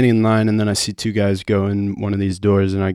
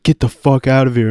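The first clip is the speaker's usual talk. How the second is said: raised, higher voice ordering out; forceful retold dismissal; retold bouncer's order; 5 semitones higher, 5 dB louder than usual. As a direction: imitate an angry bouncer.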